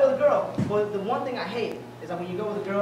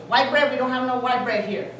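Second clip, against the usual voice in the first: higher, louder imitation of a Filipino accent; Filipino lady; 4 semitones higher, 7 dB louder than usual. Filipino sandwich lady asking the customer, Filipino accent. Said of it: "there was this Filipino lady there".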